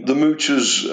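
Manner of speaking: ordinary delivery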